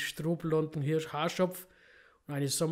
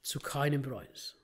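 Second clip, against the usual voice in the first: breathy hushed whisper, slowing at the end; dramatic whisper; 4 dB quieter, slower than usual. Shown as a demonstration. Reader repeating the line for effect, whispered. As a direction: whisper it like a grave secret. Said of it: "repeats line as whisper".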